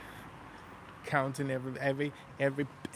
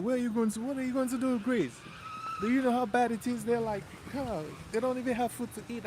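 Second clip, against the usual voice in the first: higher, incredulous questions; French people asking why he would go to Greece, higher and incredulous; imitating French travellers; 10 semitones higher than usual. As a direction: mimic worried French passengers. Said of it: "French people would be like".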